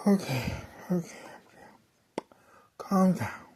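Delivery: hushed whisper, steadying; a breathy self-soothing whisper after the panic; whispering to herself. Retold or imitated. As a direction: whisper it to calm yourself.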